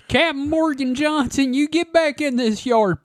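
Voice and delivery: high hillbilly mom hollering; hillbilly accent. Acted. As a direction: call the boy in like a backwoods mother. Mother calls son Captain Morgan.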